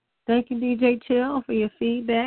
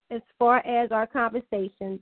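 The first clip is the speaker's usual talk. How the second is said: ordinary delivery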